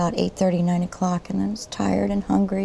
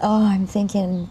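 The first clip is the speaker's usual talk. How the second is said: usual speaking voice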